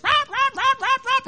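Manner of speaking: high, loud yapping barks; imitated dog barking. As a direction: bark like a pack of wild dogs. Imitating a pack of wild dogs.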